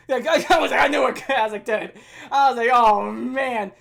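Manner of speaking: loud words broken by laughter; laughing disbelief. Retold or imitated. Boy seeing officers enter the classroom, loud laughing disbelief. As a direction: laugh through the dread of getting caught.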